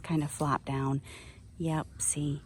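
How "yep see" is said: hushed explanation in a whisper. Real, not acted. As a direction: whisper it to the viewers. Whispering beside the squirrel.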